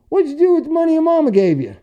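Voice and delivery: high, fast mocking old guy voice; high, fast taunting old-timer; old clerk's taunt. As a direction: needle the kid like a crusty old clerk. Old truck stop clerk mocking him.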